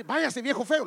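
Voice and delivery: loud, high mock tantrum; imitated child's rude yell; bratty child. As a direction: shoo someone off like a spoiled brat. Spoiled child rejecting father.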